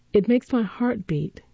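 ordinary delivery, nothing marked

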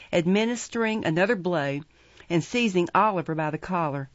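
usual speaking voice

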